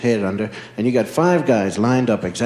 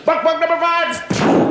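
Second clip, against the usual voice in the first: much higher, slower game call; kid calling out; 4 dB louder, slower than usual. Retold or imitated.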